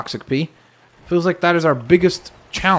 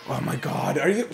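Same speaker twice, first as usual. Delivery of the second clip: ordinary talk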